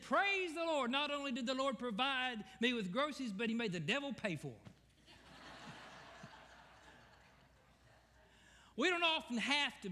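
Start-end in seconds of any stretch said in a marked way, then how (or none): 0.0-4.6 high, raised punchline, fast at the end; triumphant old lady. Retold or imitated. Old lady answering the atheist, high and gleeful. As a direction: land the joke with glee. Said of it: "She said".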